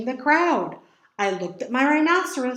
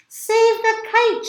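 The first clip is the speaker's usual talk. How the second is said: high, louder, slow plea; urgent shout; 11 semitones higher, 5 dB louder, slower than usual. A narrator's reading. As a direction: shout for help. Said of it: "shouted Mole".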